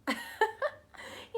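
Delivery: bright, high-pitched laugh; high burst of laughter. Real, not acted. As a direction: laugh out loud, amused. Laughs at husband's eager question.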